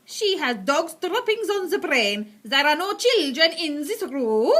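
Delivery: slow, loud scornful witch; Grand High Witch voice. Acted. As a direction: dismiss it with contempt.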